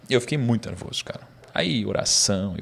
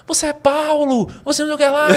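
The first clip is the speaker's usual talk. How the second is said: much higher, louder crowd cry; crowd shouting; 5 dB louder than usual. Retold or imitated. Audience shouting praise at the speaker, high and loud. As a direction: call out like a fervent audience. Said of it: "O pessoal começou a gritar".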